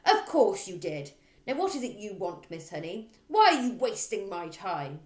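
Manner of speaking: loud barking rant; loud bossy headmistress. Acted. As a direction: hammer every line like a bully. Trunchbull voice.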